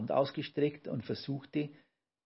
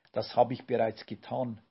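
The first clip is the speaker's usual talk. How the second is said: ordinary delivery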